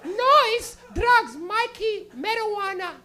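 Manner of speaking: put-on high, slow Portuguese accent, revelation; Portuguese mother with a heavy accent, realising her son smokes weed; Portuguese mom. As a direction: piece it together like a revelation. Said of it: "she went".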